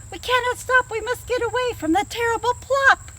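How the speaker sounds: high, anxious rabbit voices; frightened rabbits answering the fox; rabbits crying back. Acted. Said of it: "the rabbits cry back".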